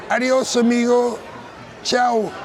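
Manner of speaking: high, bright mock Spanish farewell; breezy like a holiday waiter; Spanish goodbye.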